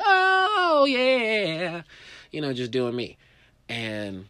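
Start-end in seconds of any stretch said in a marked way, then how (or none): 0.0-1.8 squeaky high, cheerful "oh yeah"; mock cheerful. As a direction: toss it off like a happy nobody. Carefree self, mock cheerful.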